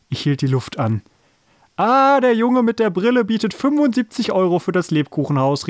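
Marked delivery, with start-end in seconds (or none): 1.8-5.7 high, excited auctioneer's announcement; calling out. A narrator's reading. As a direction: seize on the bid and proclaim it. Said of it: "rief der Bäcker".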